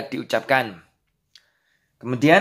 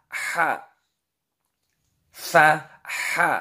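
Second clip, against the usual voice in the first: higher drill recitation; syllable drill; 4 semitones higher, much slower than usual. Shown as a demonstration. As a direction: read syllables aloud for the class. Reading drill of letters.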